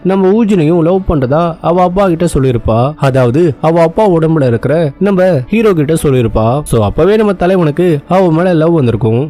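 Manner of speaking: plain reading voice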